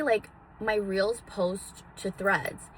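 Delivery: normal talking voice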